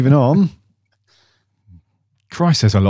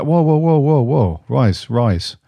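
higher string of protesting whoas, settling down; mock objection; 5 semitones higher, slower than usual. A lot of people have jumped on this, mock objection. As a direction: imitate fans calling a halt.

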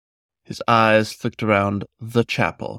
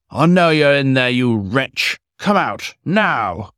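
louder, higher, hammering at the door; furious shout; 5 semitones higher, 4 dB louder, slower than usual. A narrator's reading. Magistrate pounding on the locked door, furious shout. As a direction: bellow to flush him out.

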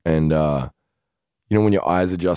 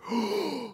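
sharp gasp; 10 dB quieter than usual. Retold or imitated. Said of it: "the breath got taken out of me".

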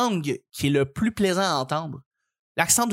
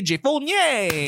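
"Jay Fournier" drawn out slowly, higher, like a gameshow host; announcer intro; 9 semitones higher, 4 dB louder, much slower than usual. Acted. Host introduces his guest like a showman. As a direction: present the guest with a big slow build.

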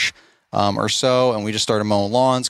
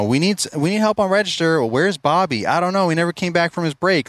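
lightly voiced harried coworkers, pitched higher; acted staff exchange; 7 semitones higher than usual. Staff calling for help on register, harried. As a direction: imitate a hurried back-and-forth at work, higher voice.

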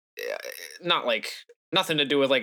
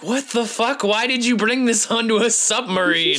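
shocked outburst; 7 semitones higher, 4 dB louder than usual. Retold or imitated. Friend's character reacts to grenade launcher.

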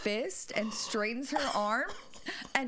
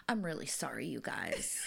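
meek apology; 7 semitones lower, 4 dB quieter than usual. Retold or imitated. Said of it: "Nona goes".

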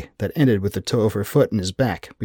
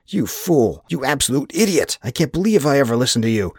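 higher-pitched angry berating; furious outburst; 6 semitones higher, 3 dB louder than usual. A narrator's reading. Rudolph berates Anders for misleading him, furious. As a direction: lash out at the one who let you down.

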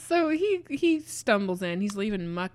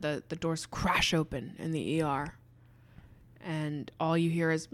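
ordinary delivery

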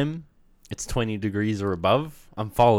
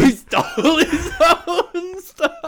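laughing; 7 dB louder, much slower than usual. Real, not acted. Laughing disbelief at story.

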